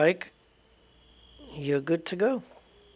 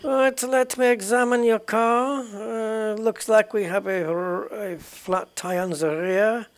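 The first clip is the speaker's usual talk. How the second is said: higher, louder put-on German inspector; German accent; 7 semitones higher, 5 dB louder, slower than usual. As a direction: play a German officer inspecting a car. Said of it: "so I'm gonna do a German one".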